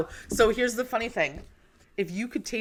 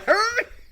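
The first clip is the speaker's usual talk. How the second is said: high laugh; 3 dB louder than usual. Real, not acted. Laughing at her facial expressions.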